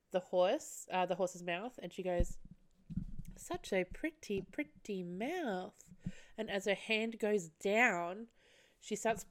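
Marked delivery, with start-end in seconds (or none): 3.4-5.7 slow, hushed, creepy coo; soft, lingering creepy child admiration; creepy kid. Retold or imitated. Girl stroking the horse's mouth, creepy hushed coo. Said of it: "her voice is just as fucking creepy".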